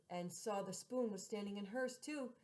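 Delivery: plain reading voice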